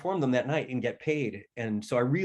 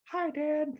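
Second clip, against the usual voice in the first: put-on very high, small kid voice; imitated high child's voice; cute little boy. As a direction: squeak it like a sweet little son greeting Dad. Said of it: "that's how he sounded".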